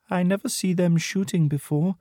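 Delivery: put-on high-pitched girl soldier voice. A narrator's reading. Girl soldier watching the shooting, high girlish voice. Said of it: "she says".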